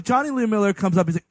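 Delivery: ordinary delivery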